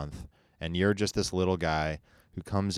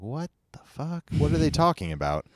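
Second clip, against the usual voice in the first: high, incredulous what-the-heck; baffled disbelief; 9 semitones higher than usual. Retold or imitated. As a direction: react in stunned confusion. Retold baffled reaction to email.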